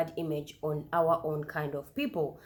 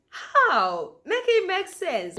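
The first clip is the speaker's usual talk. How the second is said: high, loud disbelieving cry; louder and much higher in disbelief; incredulous outburst; 12 semitones higher, 6 dB louder, slower than usual. Real, not acted. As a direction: demand it make sense, exasperated. Baffled reaction to a claim.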